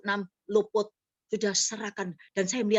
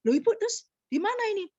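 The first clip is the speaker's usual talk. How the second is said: surprised caller; 11 semitones higher, faster than usual. Retold or imitated. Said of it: "Terus dia tanya begitu".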